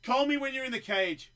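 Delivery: high, loud challenge yell; shouting. Retold or imitated. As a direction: yell it across the gym. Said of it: "I'm just like".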